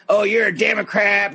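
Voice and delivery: mock shouted accusation; imitated angry retort. Man at Starbucks hitting back at her, mock shout. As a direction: throw it back as a hammed-up jab.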